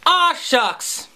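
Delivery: put-on high folksy aw-shucks boy; hammed-up innocent small-town boy; Opie. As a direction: chirp it high like a wholesome TV kid. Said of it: "Apparently I'm Opie".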